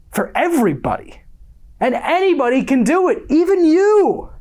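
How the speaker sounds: fervent self-help pitch, climbing to a near shout; rousing exhortation. Acted. Motivational monologue building to a climax.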